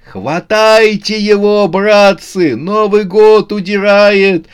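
high, loud, drawn-out alarm; shouting. A narrator's reading. Братцы-месяцы see Новый год escaping, shouting. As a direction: raise the hue and cry. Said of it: "закричали братцы-месяцы".

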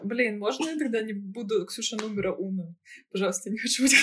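ordinary talk

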